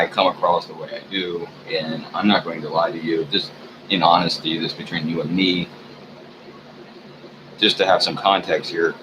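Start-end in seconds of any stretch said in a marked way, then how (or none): none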